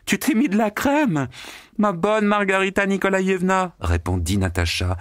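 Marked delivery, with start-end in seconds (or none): 0.0-1.3 high excited call. A narrator's reading. Margarita calls to Natasha in flight. 1.8-3.8 high, delighted shout; joyous clamour. A narrator's reading. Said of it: "réveillant par ses clameurs".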